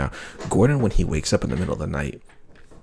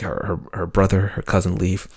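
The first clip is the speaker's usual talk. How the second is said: ordinary talk, nothing marked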